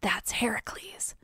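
hushed aside, barely voiced; whisper. Whispering over to mom at the play, whisper. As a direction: whisper it softly to someone beside you. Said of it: "I whisper over to my mom".

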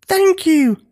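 high, small-creature shout; high-pitched grateful cry. A narrator's reading. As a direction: call up to a giant. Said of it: "shouted Octavia".

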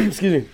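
laughing throat clear; embarrassed throat clear. Real, not acted.

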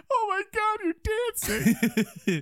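very high, overacted wail; hammed-up high cry of disbelief; mock anguish. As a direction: wail it in a squeaky put-on voice, dragging the end. Acts out crawler's anguished shock.

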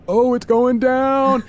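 high, drawn-out excited exclamation; gleeful anticipation. Acted. Listener watching FBI agents close in, gleeful anticipation. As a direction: stretch it out high, like trouble is coming.

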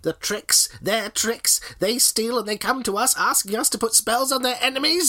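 lightly voiced witch; a hint of an old witch. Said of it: "I'll do a witch voice".

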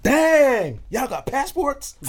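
high, loud, slow and amazed; amazed American girl. Retold or imitated. Party girl amazed at his passport, high and slow. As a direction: drag out her amazement. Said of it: "She's like".

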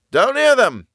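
high, loud yell; yelling back. A narrator's reading. Tom answering Bill in the trench, yelling. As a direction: yell the answer across the din. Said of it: "Tom yelled back".

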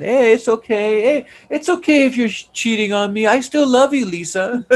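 high-pitched, forgiving lover imitation; high mock-sweet reassurance; Johnny. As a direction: mimic an oblivious lover excusing everything, voice way up. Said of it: "everything's love, passion".

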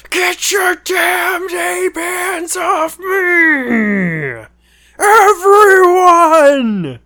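very high, hugely drawn-out theatrical scream; over-the-top movie villain howl, stretching every word; Gary Oldman impression. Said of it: "which Gary Oldman do you do an impression of".

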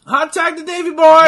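high, loud play-by-play call; excited commentator shout. Real, not acted. Commentator calls the hot tag to Davey Boy, excited shout. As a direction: shout the big moment.

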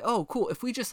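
mock officials. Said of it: "twisted logic of".

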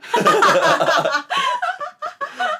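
high, loud burst of laughter. Real, not acted. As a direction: laugh out hard at the punchline. Laughing at the kids' answer. (高声大笑)

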